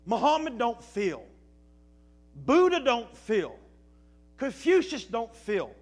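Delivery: loud, punched list of names; emphatic preaching list. Acted. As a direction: hammer each name home. Preacher lists contrasts.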